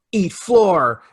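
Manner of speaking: lightly imitated fight taunt, punched out; Batman impression. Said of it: "He goes".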